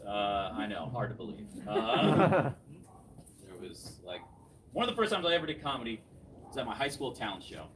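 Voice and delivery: ordinary talk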